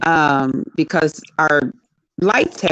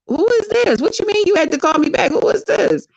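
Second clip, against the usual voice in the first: high, suspicious questioning, pitched way up; startled who-is-this; 10 semitones higher than usual. Retold or imitated. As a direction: demand to know who's calling, pitch high. Retelling a startled phone call.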